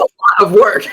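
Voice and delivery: breaks into a high laugh mid-complaint; real laugh bursting out. Real, not acted. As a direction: laugh through the frustration. Laughs while getting upset.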